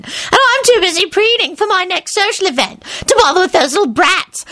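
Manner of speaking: high grating falsetto mockery. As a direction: sneer it in a shrill high falsetto. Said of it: "a familiar grating falsetto voice".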